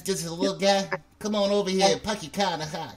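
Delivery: playful put-on Boston accent; mock Boston accent. Host imitating the little guy, mock Boston accent. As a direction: tease with a Boston impression. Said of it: "That sounded like a Boston accent".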